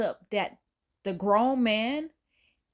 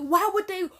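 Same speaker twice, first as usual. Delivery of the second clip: normal talking voice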